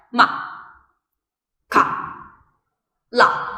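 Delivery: short falling ma, ka, la; quick final fall on ma, ka, la; fourth tone. Shown as a demonstration. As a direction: drop each of "ma, ka, la" abruptly, like a full stop. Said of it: "it's very short and it's like falling".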